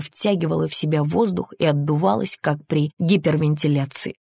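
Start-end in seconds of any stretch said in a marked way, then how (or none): none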